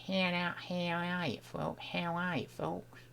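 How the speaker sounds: very quiet practice of an Australian accent; tentative, soft Australian accent; attempted Australian accent. Shown as a demonstration. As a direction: test the Aussie vowels under the breath. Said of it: "not grasped that ... the way to do that accent yet".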